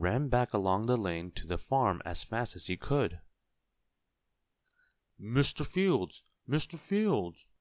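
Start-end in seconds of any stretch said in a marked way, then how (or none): 5.2-7.5 high, drawn-out hail; excited, high-pitched calling. A narrator's reading. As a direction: call urgently across a farmyard. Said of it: "he called as he ran".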